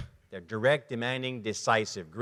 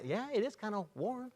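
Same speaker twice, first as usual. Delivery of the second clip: soft, higher, easygoing agreement; agreeable high S; 6 semitones higher, 10 dB quieter than usual. Retold or imitated. The high S agreeing with the stranger, soft and meek. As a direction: agree meekly. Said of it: "And the high".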